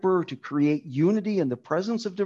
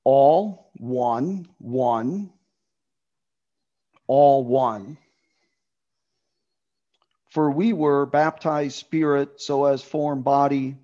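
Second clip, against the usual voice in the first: "All" and "one" punched louder, each word set apart; stress on all; 4 dB louder, slower than usual. Shown as a demonstration. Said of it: "it would read something like this".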